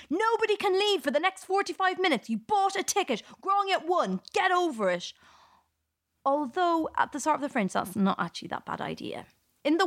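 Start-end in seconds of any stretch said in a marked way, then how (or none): 0.1-5.1 mock scream.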